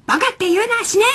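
retold angry outburst. Friend scolding her for saying baka, mock anger. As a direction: imitate a seriously angry scolding. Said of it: "ものすごい本気で怒られて".

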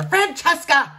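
loud, high outburst of the name; roared. A narrator's reading. Enraged king calls his daughter, roaring. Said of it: "he roared".

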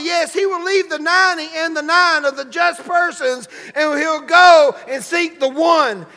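loud, high-pitched sermon delivery; full-force preaching. Real, not acted. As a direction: preach it out to the whole congregation.

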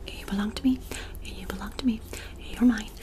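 ordinary talk

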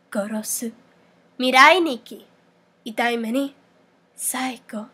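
lightly acted yandere word list; a hint of yandere, some words whispered. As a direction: say each word apart as a mild yandere. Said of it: "For the yandere voice meme".

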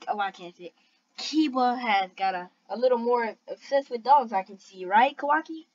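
ordinary talk

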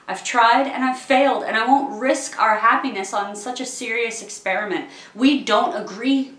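heated and insistent; emphatic refusal. Acted. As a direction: argue forcefully, raising your voice. Firmly refusing suitor's proposal.

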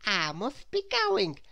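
Little Miss Scatterbrain. A narrator's reading. Little Miss Scatterbrain takes leave of friends, in high voice. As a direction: draw out a high farewell. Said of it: "said little Miss Scatterbrain".

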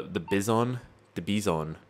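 "Bison" said as bee-ZON; "Bison" with an ee and a full -zon; mispronounced Bison. Real, not acted. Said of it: "it's called a Bison".

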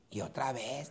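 normal talking voice

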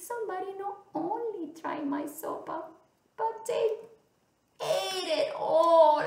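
put-on high wailing baby bear; crying baby bear. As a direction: draw out a hammed-up childish wail. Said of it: "also bebé start crying".

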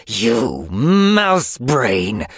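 slow, drawn-out snarl; snarling she-cat. Acted. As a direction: spit the insult slowly. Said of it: "she snarled".

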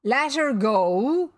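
loud, slow, incredulous question; outraged disbelief. A narrator's reading. Captain hears his prisoner was released, outraged. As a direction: echo it back, drawn out in disbelief. Captain appalled by crew's news.

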